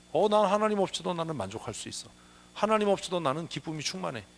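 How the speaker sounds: smug self-satisfied boast; proud Babylon. Retold or imitated. Preacher mocking proud Babylon's boast, lightly voiced. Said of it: "이래서 망한다".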